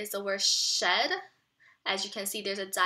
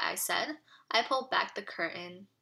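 ordinary talk, nothing marked

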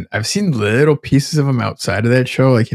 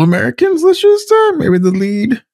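high, loud mocking squeak; pitched way up in sly sarcasm; mock innocent falsetto; sarcastic; 5 dB louder than usual. Real, not acted. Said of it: "sarcastic aside".

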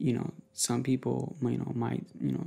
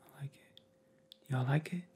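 ASMR whisper. Real, not acted. Vlogger asking viewers' opinion, ASMR whisper. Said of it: "I get my A S M R on".

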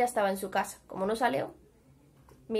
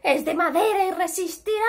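confident shout; 7 semitones higher than usual. A narrator's reading. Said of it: "Le gritó Nuf Nuf".

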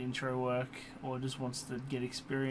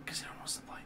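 whispering; 9 dB quieter, much faster than usual. Real, not acted. Whispered aside.

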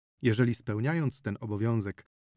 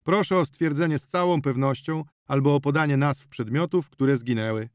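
louder, formal, by-the-book; official tone; 4 semitones higher, 5 dB louder than usual. Acted. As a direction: speak like a clerk on duty. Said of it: "urzędowym tonem". (urzędowym tonem)

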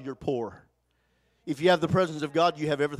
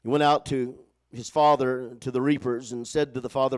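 normal talking voice

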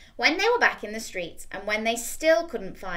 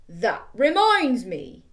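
slow, louder; big skeleton; 6 dB louder, much slower than usual. Acted. Big skeleton remembers, slow. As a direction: remember it slowly.

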